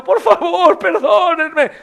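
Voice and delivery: high, loud tearful plea for forgiveness; pleading sinner. Pretend. Preacher voicing a weeping woman begging the church, high and pleading. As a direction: beg like a weeping woman interrupting the meeting. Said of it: "aquí llorando".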